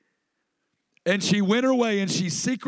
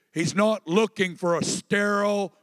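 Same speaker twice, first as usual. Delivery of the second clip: ordinary delivery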